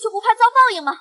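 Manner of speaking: defiant. Acted. Maid defying scheming concubine.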